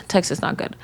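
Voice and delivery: whispered aside. Real, not acted. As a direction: drop to a whisper for the verdict. Aside about texting.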